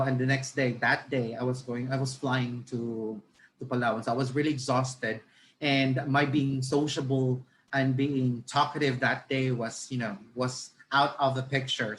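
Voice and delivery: usual speaking voice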